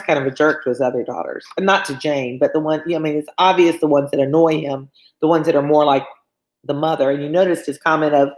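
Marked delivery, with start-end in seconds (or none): none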